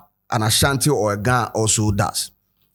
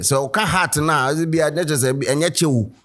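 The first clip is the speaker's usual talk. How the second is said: usual speaking voice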